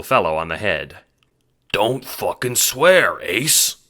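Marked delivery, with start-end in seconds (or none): 1.7-3.8 louder, slow stern scolding. Acted. Queen scolding Ace for swearing, sternly. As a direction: scold the little guy firmly. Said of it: "Queen says sternly".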